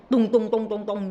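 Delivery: banging knock sounds, loud and sharp; loud door knocking. Retold or imitated. Retelling the therapist banging on her door, loud knocking. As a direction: pound out the knocks loudly. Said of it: "ketok pintunya".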